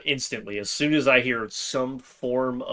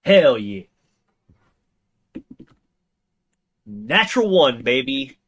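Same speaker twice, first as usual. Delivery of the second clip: excited cheer; 4 semitones higher, 8 dB louder than usual. Real, not acted. Cheering a dice roll.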